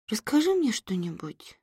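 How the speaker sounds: put-on high child voice, drowsy; sleepy mumble. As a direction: mumble it half asleep. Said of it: "сонно пробормотала она". (сонное бормотание ребёнка)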